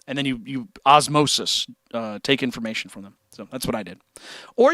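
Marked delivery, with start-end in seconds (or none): none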